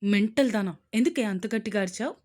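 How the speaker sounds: loud, fast angry snap; serious scolding. A narrator's reading. Husband scolding wife for screaming, loud angry. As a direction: scold her sharply, ears still ringing. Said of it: "సీరియస్గా".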